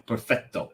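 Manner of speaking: perfetto with a double T, no C sound, stress on fet; teaching perfetto the proper Italian way. Shown as a demonstration. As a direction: correct perfetto crisply, double T held. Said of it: "It's two T's".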